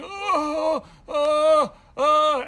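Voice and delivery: high loud moaning oh; hammed-up hurt moaning; pained groans. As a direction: groan in pain at the bottom.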